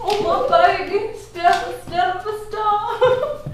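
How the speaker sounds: drawn-out, lilting endearment; gushing affection. Acted. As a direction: croon it lovingly with a laugh in it. Embracing sister, gushing over her.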